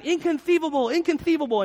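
high lisping repeated exclamation; squeaky lisped villain line; Vizzini impression. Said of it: "hears this guy saying".

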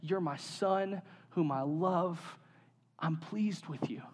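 voice of the Heavenly Father. Retold or imitated. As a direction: let it fall softly and slowly, like a father blessing his child. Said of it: "the voice of our Heavenly Father speaks over him".

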